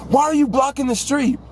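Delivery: high, mocking yell of an angry old driver; mock driver yelling out the window; imitating angry driver. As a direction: squeak it out like the honking guy. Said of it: "he yells with the window down".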